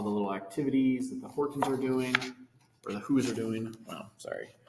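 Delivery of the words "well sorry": ordinary delivery, nothing marked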